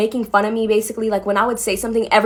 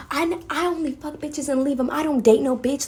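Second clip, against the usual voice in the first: higher, softer mock tough-guy voice; mocking sexist guy; 4 semitones higher, 4 dB quieter than usual. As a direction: mimic him with disdain, a touch higher. Mocking the sexist guy's words.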